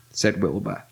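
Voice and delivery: usual speaking voice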